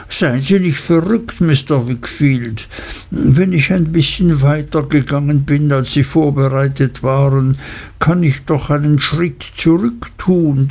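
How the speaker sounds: slippery voice backing down; placating Uriah. Acted.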